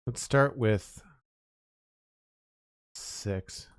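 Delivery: ordinary delivery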